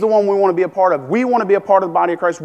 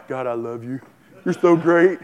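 fake praise; 4 semitones lower than usual. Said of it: "with my mouth, I seriously, I go".

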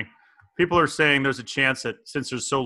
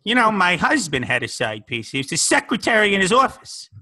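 put-on old lady; 6 semitones higher, 6 dB louder than usual. Plays an old lady character.

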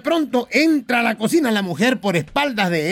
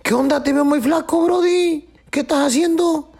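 a lightly voiced buddy, pitched up high; 8 semitones higher than usual. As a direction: play the chummy friend, a notch higher. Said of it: "Le dice un camarada al otro".

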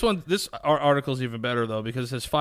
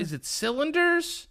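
uncertain question; 13 semitones higher, slower than usual. Real, not acted. Unsure of the word.

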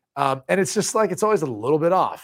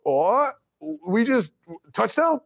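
high, rising, baffled question; unsure; 6 semitones higher, slower than usual. Retold or imitated. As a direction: play the confused official. Officials voiced, unsure.

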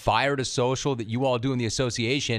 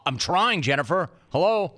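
higher, rising to an indignant "Hello?"; exasperated; 5 semitones higher than usual. Shown as a demonstration. Host performs the tweet as an exasperated retort. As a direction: sell it like you can't believe she's asking. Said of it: "how would it have sounded?".

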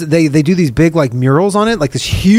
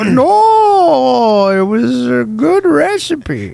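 slow, high-pitched caricature of a dad; put-on dad voice; 6 semitones higher, much slower than usual. Dad tells child of baby plans, hammed-up high voice. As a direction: drag it out in a silly high voice. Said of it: "Dad, uh, you're a little old".

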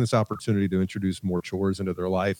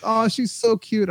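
gushing friends; 12 semitones higher, slower than usual. Retold or imitated. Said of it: "all my friends will be like".

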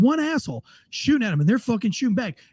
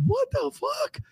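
high squeak of disbelief, much higher, softer; disbelieving what the fuck; 6 dB quieter, slower than usual. Retold or imitated. Host retelling his own reaction to thriving Ukraine, stunned squeak. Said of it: "I'm like".

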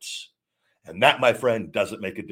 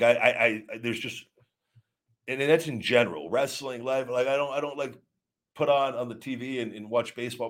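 ordinary talk

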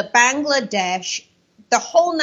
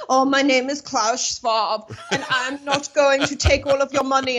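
Klaus Schwab villain; 2 semitones higher than usual. Acted. Guest mimicking the globalist for the host, perfect-villain voice. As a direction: put on a cartoonish villain voice. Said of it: "he sounds like the perfect villain".

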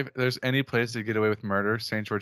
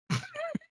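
high-pitched giggle burst; high squealing laugh; 8 dB quieter than usual. Real, not acted. Laughs at own joke.

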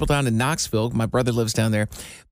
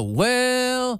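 high, drawn-out well; catchphrase well; 8 semitones higher than usual. Shown as a demonstration. Man quoting his own catchphrase to co-host, drawn out. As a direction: stretch well out, pitched up. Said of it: "you've definitely picked up on the".

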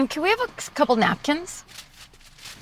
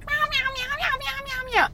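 very high, squeaky kitten mewing; soft, high little meows while eating; 5 dB quieter than usual. Retold or imitated. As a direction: mew like a tiny kitten mid-bite. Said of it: "the cat is eating and going".

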